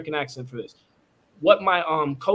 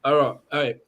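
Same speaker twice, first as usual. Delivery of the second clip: normal talking voice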